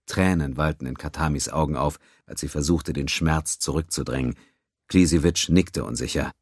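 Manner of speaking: usual speaking voice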